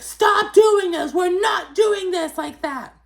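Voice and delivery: very high, loud, slowed mock screech; yelling. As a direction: yell it drawn-out, imitating someone trying to break up a fight. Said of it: "like, yelling".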